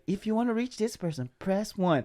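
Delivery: high phone-menu voice; automated system. Acted. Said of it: "Being the automated system?".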